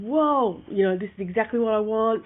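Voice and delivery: re-enacting an excited reaction; retold excitement. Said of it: "I was so excited".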